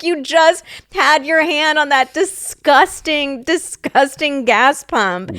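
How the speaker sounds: high, slow horrified disgust; disgusted disbelief. Retold or imitated. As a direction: stretch each word, grossed out and pointed. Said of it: "And I was like".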